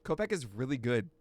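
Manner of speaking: ordinary delivery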